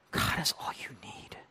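breathy hushed whisper. Real, not acted. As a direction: drop to a whisper for emphasis. Whispered climax of sermon point.

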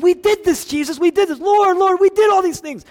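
high, loud insistent pleading; frantic protest. Acted. Rejected worshippers pleading their deeds to Jesus, frantic. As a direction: cry out your defence, voice pushed up.

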